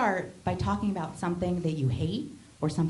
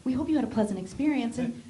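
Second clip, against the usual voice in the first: chirpy company email voice; 6 semitones higher than usual. Retold or imitated.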